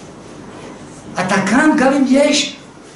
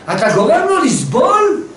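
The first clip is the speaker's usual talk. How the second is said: indignant shout; 7 semitones higher than usual. Real, not acted. Rebuking the listener.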